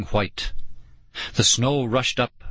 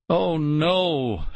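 high, lightly voiced dismay; a hint of a despairing groan; 6 semitones higher than usual. A narrator's reading. Girl staring up at the pit walls, mild groan. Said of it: "Nicole groaned".

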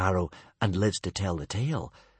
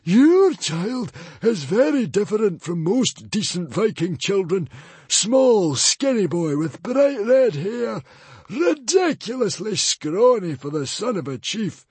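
put-on high, loud librarian voice describing the boy; a scornful high-pitched librarian listing faults; sneering librarian; 12 semitones higher, 7 dB louder than usual. A narrator's reading.

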